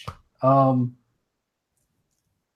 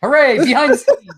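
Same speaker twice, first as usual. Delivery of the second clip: mock cheer; 11 semitones higher, 7 dB louder than usual. Mock cheer at still broadcasting.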